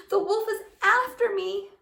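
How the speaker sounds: high, loud panicked cry; girl crying out to the woodcutter that the wolf chases her; frightened cry. Acted. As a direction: call out for help in fright.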